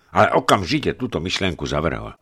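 usual speaking voice

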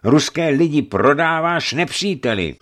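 high, loud, building indignation; angry accusation. A narrator's reading. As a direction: accuse him wrathfully, phrase by phrase. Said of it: "začal hněvivě Eufemii".

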